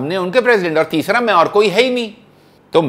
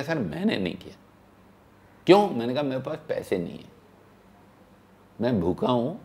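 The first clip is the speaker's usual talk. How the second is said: sheepish confession; 3 semitones lower, 8 dB quieter, much faster than usual. Retold or imitated. Hungry young man confessing softly.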